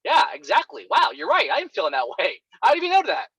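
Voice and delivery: high, excited disbelief, voice leaping up; high-pitched astonished gush; amazed client. Retold or imitated. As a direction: imitate a stunned client who suddenly feels understood. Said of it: "they'll be like".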